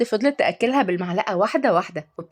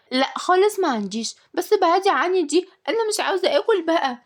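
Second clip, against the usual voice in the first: put-on high, whiny child voice; high-pitched childish protest; stubborn little girl; 10 semitones higher than usual. A narrator's reading. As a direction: refuse like a fussy child. Child refuses food again.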